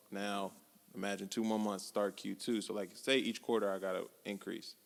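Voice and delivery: ordinary delivery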